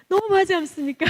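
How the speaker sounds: exasperated reproach. Retold or imitated. Missionary scolding the owner for deceiving her, exasperated reproach. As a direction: push the complaint out high and forceful.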